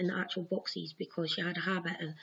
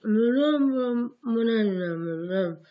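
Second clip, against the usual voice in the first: louder mumbled nonsense syllables, tongue-tied; garbled gobbledygook; 2 semitones higher, 6 dB louder, slower than usual. Retold or imitated. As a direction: sound stuck, unable to form the words. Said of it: "came out as gobbledygook".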